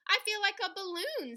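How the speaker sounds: high, bright-eyed thrill; delighted princess. Acted. Princess floating with the balloons, high and excited. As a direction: squeal it with delight. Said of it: "said the princess".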